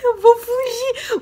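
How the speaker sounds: high, slow whiny mock cry of an old lady; mock tearful wail. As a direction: whine it like a tearful grandma throwing a fit. Mimics the old lady's wail.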